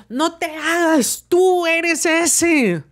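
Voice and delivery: high, dragging don't-play-dumb accusation; insistent accusation. Retold or imitated. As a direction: stretch every word, don't let him deny it.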